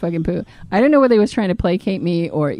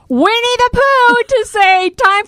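incredulous; 13 semitones higher, 4 dB louder, slower than usual. Retold or imitated. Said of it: "I had no words".

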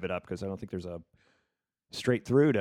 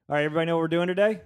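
loud, fast, higher host energy; 7 semitones higher, 7 dB louder, much faster than usual. Real, not acted. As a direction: project it loud and quick. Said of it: "I'm saying this loud".

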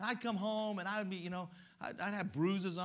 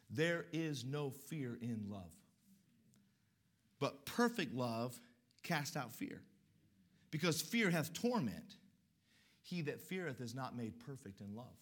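slow, solemn reading of the verse; scripture reading; 5 semitones lower, 4 dB quieter, slower than usual. Acted. Preacher reads the scripture slowly and solemnly. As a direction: read the verse slowly and reverently.

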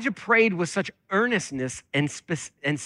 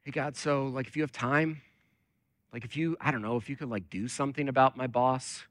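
soft, hesitant mock prayer; timid, tentative prayer; 3 semitones lower, 8 dB quieter than usual. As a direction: ask God sheepishly, barely daring to impose. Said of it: "Here's the way that you and I often pray".

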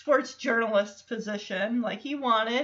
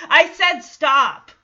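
slow, loud, irritated warning; 4 semitones higher, 11 dB louder, slower than usual. Retold or imitated. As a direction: lean in and lay down the law. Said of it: "gets right in Michelle's face".

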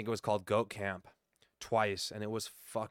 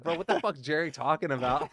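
high, loud incredulous outburst; retold disbelief; 7 semitones higher, 7 dB louder than usual. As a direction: act out being baffled by a wild rumour, pitched up. Retelling his disbelief at a rumour.

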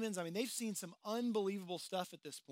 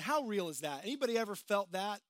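usual speaking voice

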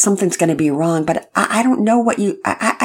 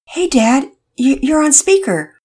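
eager; 10 semitones higher, 6 dB louder than usual. Acted. Todd answers dad's phone call.

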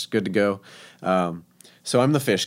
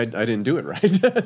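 usual speaking voice

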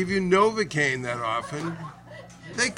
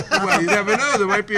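laughing through the words, higher and louder; 7 semitones higher, 8 dB louder than usual. Real, not acted. Old friend laughing at the beer joke, high and loud. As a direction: giggle it out, tickled by the joke.

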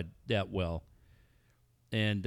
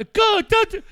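frantic high-pitched yell; going crazy; 12 dB louder than usual. Retold or imitated. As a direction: imitate a coach yelling wildly. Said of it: "he's going crazy".

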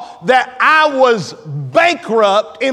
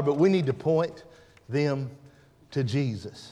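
much softer, lower, fading; hushed; 7 semitones lower, 10 dB quieter than usual. Real, not acted. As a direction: drop the voice to almost nothing. Preacher dropping to hush.

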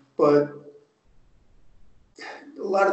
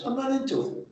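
higher, lightly voiced polite no thanks; polite refusal; 8 semitones higher, 5 dB quieter than usual. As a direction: brush it off like turning down a drink. Acting out turning down an offer.

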